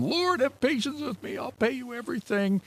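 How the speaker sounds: high, begging plea for patience; pleading servant. Acted. Servant on his knees begging the king, high pleading voice. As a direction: beg the king like a desperate debtor.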